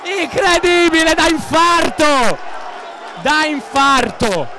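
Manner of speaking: high-pitched shouted goal call, breathless; breathless italian goal commentary. Acted. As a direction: yell the goal like a stadium commentator. Live goal call, late goal.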